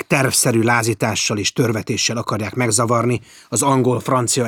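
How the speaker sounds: loud, forceful indignant officer; loud indignant naval officer; outraged report. Acted. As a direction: declare it with righteous fury.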